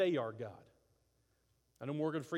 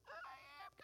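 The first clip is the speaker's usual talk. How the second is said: tiny voice; 16 dB quieter, much faster than usual. Retold or imitated. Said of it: "tiny speck of sand going".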